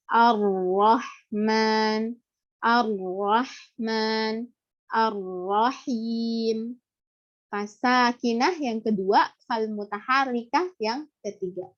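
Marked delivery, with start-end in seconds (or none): 0.1-6.8 "Ar-Rahman" drawn out very slowly, doubled r; slow recitation demo. Shown as a demonstration. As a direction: recite "Ar-Rahim" slowly with held r. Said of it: "ar-ra musyaddah". (membaca "Ar-Rahman" dengan sangat lambat)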